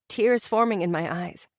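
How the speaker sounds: ordinary delivery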